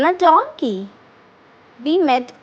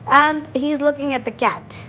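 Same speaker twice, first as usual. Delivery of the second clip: normal talking voice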